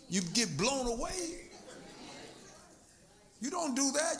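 usual speaking voice

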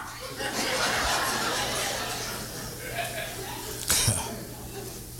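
breathless, soft high laugh; high wheezing laugh. Real, not acted. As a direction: let out a long airy wheeze of a laugh. Laughing at his own story.

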